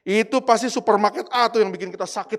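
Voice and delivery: loud retold grumbling; angry scolding. Father blaming the supermarket at the table, loud scolding. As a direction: imitate a father grumbling in anger. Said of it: "Dia masih marah-marah, bilang begini".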